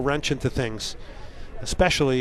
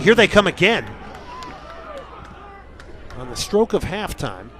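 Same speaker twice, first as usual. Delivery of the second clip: excited; 6 semitones higher than usual. Real, not acted. Attack on stroke of halftime.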